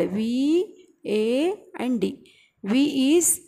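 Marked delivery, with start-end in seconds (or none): 0.0-2.3 V, A and D spaced out slowly, letter by letter; slow down and give each letter its own beat; spelling out mnemonic. Shown as a demonstration. Teacher spelling out vad slowly for students to remember. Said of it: "easy for you to remember vad".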